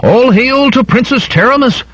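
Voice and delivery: high, strained cheer from an onlooker; high mock shout held back in volume; crowd acclamation shout. A narrator's reading. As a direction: hail a princess in a high-pitched cry. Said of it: "scattered shouts rose of".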